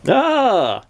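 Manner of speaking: high-pitched royal ah; goblin king. A narrator's reading. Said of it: "said the king".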